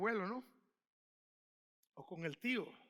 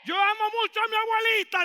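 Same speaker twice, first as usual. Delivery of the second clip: higher and louder call-out of "Yo amo mucho a mi abuelita"; louder repeat; 10 semitones higher, 11 dB louder, faster than usual. Shown as a demonstration. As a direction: push the crowd to shout it back. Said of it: "como que nadie lo dijo, no le gustó". (repetición más fuerte y aguda)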